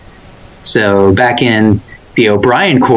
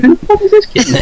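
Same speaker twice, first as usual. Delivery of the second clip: Worf voice. Retold or imitated. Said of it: "Worf is like".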